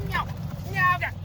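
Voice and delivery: high, loud frantic yelling; real panic, shouting; panicked shouting. Real, not acted. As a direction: scream in alarm at the driver.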